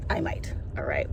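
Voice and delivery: soft unvoiced whisper. Real, not acted. Whispering to viewers she might trip. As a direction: breathe it like a secret.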